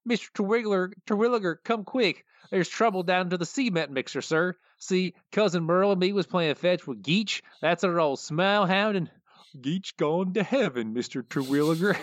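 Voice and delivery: a dim, earnest country yokel bringing bad news; high drawling yokel, sad about his dog; Cletus voice. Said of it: "I'll start out doing the Cletus voice".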